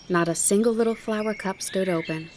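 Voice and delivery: usual speaking voice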